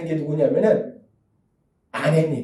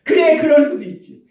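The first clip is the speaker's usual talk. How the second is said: higher, easy accepting tone; 7 semitones higher than usual. Shown as a demonstration. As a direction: let it go kindly. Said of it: "이렇게 화내는 게 아니라". (너그럽게 받아들이는 말투)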